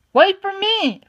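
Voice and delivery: high, loud call after him. A narrator's reading. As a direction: shout to stop him leaving. Calling after a departing cat.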